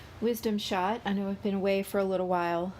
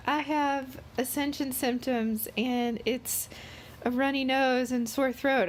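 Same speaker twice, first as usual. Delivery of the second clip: mocking voice; 8 semitones higher than usual. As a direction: put on a silly whiny voice. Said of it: "I love how everybody's like".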